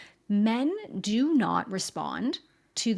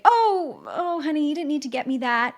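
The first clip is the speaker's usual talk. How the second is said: high, loud fluttery "Oh, honey" brushing off a gift; mock deflecting gift; 6 semitones higher, 5 dB louder than usual. Woman brushing off her husband's gift, mock fluster. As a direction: squeal and wave the gift away. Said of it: "you might say".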